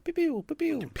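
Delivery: high-pitched "pew" laser shots; squeaky mock gunfire; playful gun noises. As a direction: make toy blaster sounds like a kid. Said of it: "were you making the noises like".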